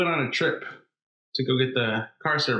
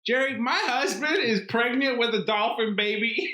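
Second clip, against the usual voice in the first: high-pitched, hammed-up Southern guest; Southern accent; 9 semitones higher than usual. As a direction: plead in a broad Southern accent. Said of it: "going in hard on the Southern accent".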